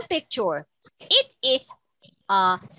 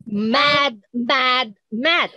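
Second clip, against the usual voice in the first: ordinary delivery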